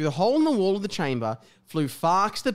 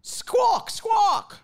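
high squawking bird call. Acted. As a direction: squawk like a phoenix. Phoenix sound read aloud.

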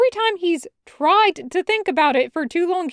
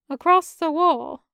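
usual speaking voice

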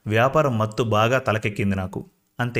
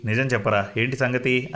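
usual speaking voice

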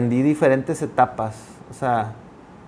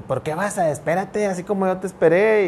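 higher, pleading call to wait up; mock complaint; 6 semitones higher than usual. Spouse left behind calls out to partner ahead, mock complaint. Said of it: "Y uno dice".

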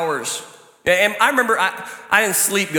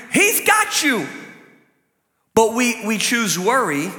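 ordinary talk

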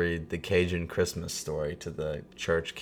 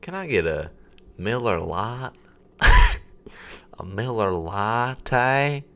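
drawn-out, higher mock Southern drawl; 5 semitones higher, 4 dB louder, slower than usual. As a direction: stretch "Latte" out very slowly. Acting redneck ordering beer latte.

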